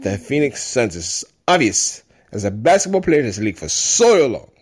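high, laughing mock French accent; French accent impression. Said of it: "you gotta read it in his voice".

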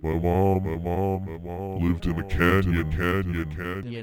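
words repeating and fading like a canyon echo; built-in echo on every phrase. Acted. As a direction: echo each phrase, letting the repeats die away. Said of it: "my voice echoes all the time".

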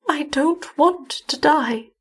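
high, slow, weakened woman; pained pleading. A narrator's reading. Dying wife to her husband, pained and pleading. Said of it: "a look of pain across".

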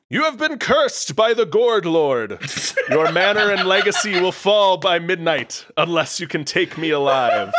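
theatrical pumpkin-villain threat; villainous shout. Acted. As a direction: shout a curse down from high above, ending in a laugh. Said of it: "rises twenty feet above you in the garden and shouts".

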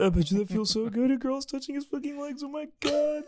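high, hammed-up envious whining; mocking a boy jealous of a friend's hairy legs, high whine. Mocking jealous kid at camp.

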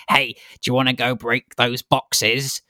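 put-on slow, goading kid voice; drawn-out little tempter. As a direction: egg someone on like a mischievous child. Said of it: "this little kid's like".

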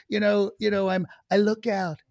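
imitated president's rambling speech; put-on presidential speech voice; Trump. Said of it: "at the C I A and he says".

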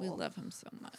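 whispering. Pretend. Hushed joke after cursing.

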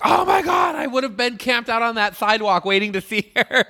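high, laughing excited gush. Retold or imitated. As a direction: gush like a starstruck fan. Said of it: "I remember being like".

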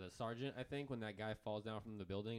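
normal talking voice

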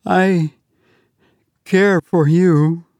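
put-on high, slow, earnest girl's voice; a girl confessing love, slowly; Tessie's confession.

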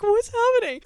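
high, loud squeal of disbelief; overwhelmed disbelief. Retold or imitated. Fan reacting to Jonas Brothers news with a high squeal. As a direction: squeal it out, stunned and thrilled. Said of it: "I was like".

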